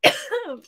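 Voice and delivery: sharp real coughing fit; hacking cough from dust. Real, not acted. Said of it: "the dust is getting to [name]".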